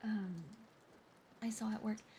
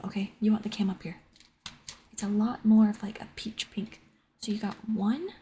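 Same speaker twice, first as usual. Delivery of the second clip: suddenly much louder, mic too hot; blasting at full volume into the mic by accident; 14 dB louder than usual. Real, not acted. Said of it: "I have to be so quiet".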